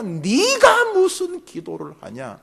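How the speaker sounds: slow and loud, each word hammered; loud, slow accusation. Retold or imitated. Accusing voice grows clear and loud in prayer, slow. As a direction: bellow the question slowly. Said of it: "소리는 뚜렷이 들리기 시작합니다".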